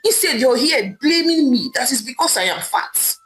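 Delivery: ordinary delivery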